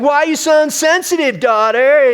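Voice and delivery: high, loud, whiny mock accusation; exaggerated accusing child. Dad parodies his daughter's scolding, high and whiny. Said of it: "she didn't really say it like that".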